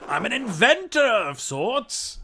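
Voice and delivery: high, loud eccentric professor voice; kooky mad scientist. Acted. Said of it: "like a mad scientist voice".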